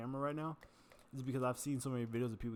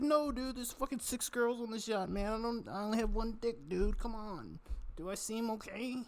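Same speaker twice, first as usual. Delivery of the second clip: high, whiny wail of self-pity; mock crying; 11 semitones higher, slower than usual. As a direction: imitate a distraught man in a squeaky-high voice. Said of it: "he just started crying in his hands".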